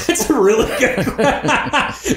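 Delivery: high, slow, through big laughter; laughing. Real, not acted. Cracking up at the co-host's donkey joke, laughing. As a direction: laugh hard while talking.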